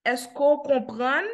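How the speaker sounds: ordinary delivery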